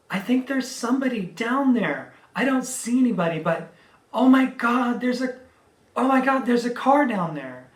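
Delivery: high, loud, rushed exclamations; excited alarm. Retold or imitated. Ladies on the road spot the wrecked car below, loud and high. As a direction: imitate the ladies' shocked discovery. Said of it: "And they're like".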